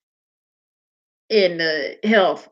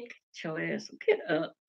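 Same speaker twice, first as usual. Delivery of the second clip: much softer, hushed urging; quiet retold hiss to a friend; retold whisper; 5 semitones lower, 14 dB quieter than usual. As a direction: whisper it so nobody else hears. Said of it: "I kept whispering".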